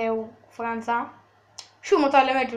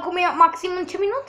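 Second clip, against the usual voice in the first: normal talking voice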